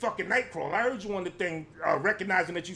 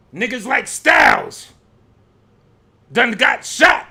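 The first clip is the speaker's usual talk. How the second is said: loud, heated rant; heated yelling; 6 dB louder, slower than usual. Real, not acted. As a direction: yell it out, fired up. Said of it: "really got me heated".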